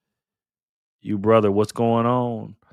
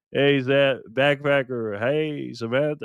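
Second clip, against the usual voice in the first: ordinary talk, nothing marked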